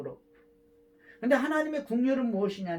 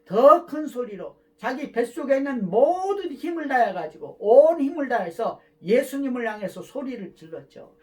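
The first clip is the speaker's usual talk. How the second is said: preaching loud; 2 semitones higher, 7 dB louder than usual. Real, not acted. Preacher building to a shout.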